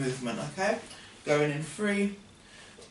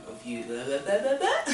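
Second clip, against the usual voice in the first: laughing; 2 semitones higher than usual. Real, not acted.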